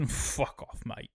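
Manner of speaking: Daemon's dismissive whisper, unvoiced; whispered brush-off. Daemon waving off Ulf's Targaryen claim, in a whisper. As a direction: breathe it out like a sneering aside. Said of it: "Daemon's like".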